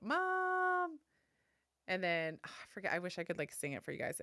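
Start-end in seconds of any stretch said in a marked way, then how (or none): 0.0-1.0 high, loud cartoon call. Mom imitating the Bluey opening, a high loud call. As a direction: call Mom across the house like the show's opening. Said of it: "it starts with calling out".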